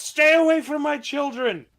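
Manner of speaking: high, loud shout of warning; protective shout. Acted. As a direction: shout it like a mother guarding her young. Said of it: "and shouts".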